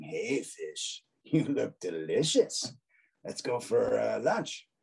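sly shark. Acted. Shark with angled eyebrows tempts fish, sly hungry voice. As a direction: tease it like a shark eyeing lunch. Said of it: "He looks a little more mischievous".

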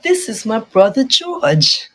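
imitated British cartoon accent, lilting up and down; Peppa Pig. Introducing brother George in a mock British cartoon accent. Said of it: "Peppa Pig accent".

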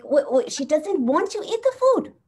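high, loud, scandalised disbelief; African elders quoted. Retold or imitated. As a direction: imitate an appalled elder pressing the point. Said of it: "They're like".

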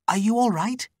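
very high, concerned question; high female character voice, rising in concern; concerned servant woman. Acted. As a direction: ask anxiously after her through the door. Layla checks on captive.

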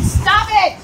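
sudden loud, high outburst; exasperated yell. Real, not acted. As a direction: yell it out, fed up with the wind. Yelling at the wind.

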